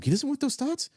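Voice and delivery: a startled minion asking in disbelief; panicky minion. Acted. Minions behind him, alarmed at the rejected thoughts, squeaking high. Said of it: "the other minions behind him".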